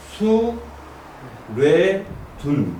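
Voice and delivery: syllable by syllable. Shown as a demonstration. Teacher showing how the third hexagram is read, syllable by syllable. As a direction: leave a clear pause after each syllable. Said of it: "이렇게 읽는 거예요".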